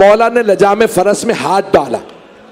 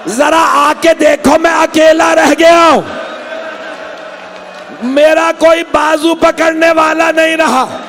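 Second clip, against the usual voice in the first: longing call; 5 semitones higher, slower than usual. A narrator's reading. Hussain calling his fallen companions, a high drawn-out wail. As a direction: cry out to the fallen with aching longing. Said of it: "फिर बड़ी हसरत से आवाज दी".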